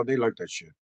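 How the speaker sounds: usual speaking voice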